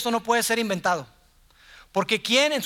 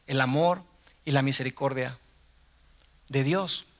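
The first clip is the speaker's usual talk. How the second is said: ordinary talk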